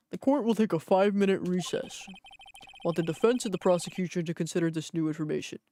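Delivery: weighty judge summing up testimony; courtroom judge. Acted. Judge recaps testimony to the witness, in a judge's voice. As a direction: preside over court in a put-on judge voice.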